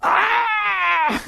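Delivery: high, drawn-out scream; long, high-pitched "aah"; mind's scream. Acted. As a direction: shriek as the panicking mind. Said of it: "your mind goes".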